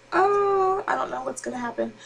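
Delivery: mock unsure. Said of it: "I'm just like".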